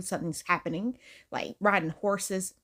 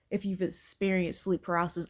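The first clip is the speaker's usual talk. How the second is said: ordinary talk, nothing marked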